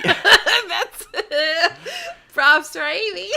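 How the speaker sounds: loud laughter through the words; real helpless laugh while talking; cracking up. Real, not acted. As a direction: barely get it out for laughing. Wife cracks up at own expense.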